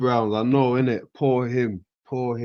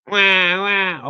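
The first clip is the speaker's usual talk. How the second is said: mock crybaby wails; 9 semitones higher, 4 dB louder than usual. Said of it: "She's throwing a fit".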